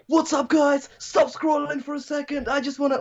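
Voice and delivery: very high-pitched, over-the-top creator intro; hyped influencer. Pretend. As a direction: put on a squeaky social-media hype voice. Mimics hyped creator's video opener.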